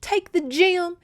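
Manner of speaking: high, loud, urgent command; urging. A narrator's reading. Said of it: "she urged".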